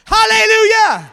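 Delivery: high, loud hallelujah; shout it back. Shown as a demonstration. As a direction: call it out for the room to answer. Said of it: "Come on, shout it back".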